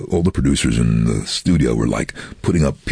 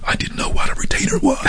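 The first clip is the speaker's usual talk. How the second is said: whispered aside. Real, not acted. Unvoiced aside.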